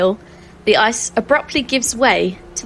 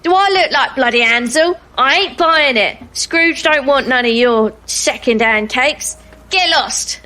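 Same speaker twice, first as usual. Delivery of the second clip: scornful, higher-pitched gatekeeper; disgruntled guard; 4 semitones higher than usual. Acted. Guard rejects the cake and visitors, indignant and grumpy. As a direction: refuse them with indignant, raised pitch. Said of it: "the guard takes in the, uh, the cake and you and your companions and says".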